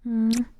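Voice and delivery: a big smacking air kiss; loud kiss sound. Real, not acted. Streamer blows a fishy kiss to her followers, loud smack. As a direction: blow a loud kiss to the chat. Sends fishy kisses to chat.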